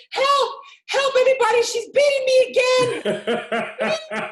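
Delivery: high, loud hammed-up child yelling; mock cry for help. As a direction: scream for rescue in a mock panic. Child calling for help, retold.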